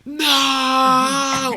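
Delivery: long, high wailing "no"; hammed-up scream. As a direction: scream in mock despair at the top of your lungs. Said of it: "I scream at the top of my lungs".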